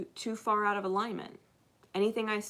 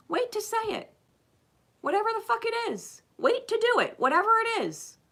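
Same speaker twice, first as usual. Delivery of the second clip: much higher, clipped insistence; high insistent urging; 13 semitones higher, 3 dB louder than usual. Acted. Speaker urging viewers to wait, held high. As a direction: keep it high and pressing. Urging listeners to hold back.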